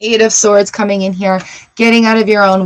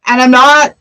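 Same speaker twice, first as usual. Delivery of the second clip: usual speaking voice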